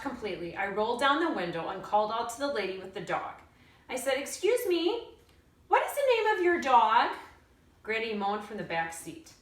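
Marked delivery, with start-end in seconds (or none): 4.2-7.1 calling out. A narrator's reading. Calling out to the lady.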